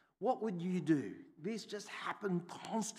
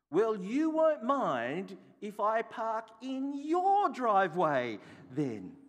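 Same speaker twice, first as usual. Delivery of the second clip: louder, higher, slow sarcastic comeback; cheeky retort; 6 semitones higher, 6 dB louder, slower than usual. Retold or imitated. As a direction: give it back to them slowly. His cheeky retort, retold.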